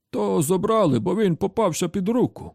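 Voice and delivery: put-on high-pitched old grandad voice; grandfather character. A narrator's reading. Grandfather telling how his twin was taken, in high old-man voice. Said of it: "часто розповідав їм дід".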